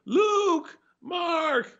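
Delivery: high hollered names across a room; calling out. Retold or imitated. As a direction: yell up to the stage from the cheap seats. Calling out to actor from bleachers.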